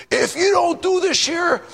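high, strained mock church threat; threatening. Preacher mimicking churches that threaten members, threatening. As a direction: imitate a bossy fundamentalist laying down the law. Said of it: "and they threaten you".

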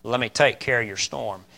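soft, low reassurance; quiet, tender promise; very calm. Acted. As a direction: gently take the burden off them. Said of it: "very calmly steps up and says".

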